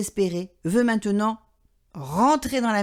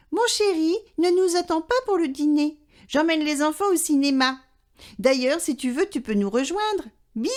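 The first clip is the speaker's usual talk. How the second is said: a lightly voiced wife, much higher; sweet message from the wife, pitched up; wife on the answering machine; 6 semitones higher than usual. A narrator's reading. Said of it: "la voix de sa femme qui dit".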